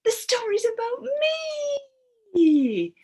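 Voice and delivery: high, loud, eager cry; a puppet insisting the story is about it; excited fish puppet. Acted. Puppet fish telling the class, loud high cry. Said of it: "Libby Fish says".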